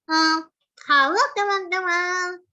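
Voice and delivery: high, slow, loud altered hello; tongue to the other side, drawn out. Shown as a demonstration. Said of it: "terus ke sebelah sini pasti akan menghasilkan suara berbeda". (sapaan lambat dengan lidah ke sisi lain)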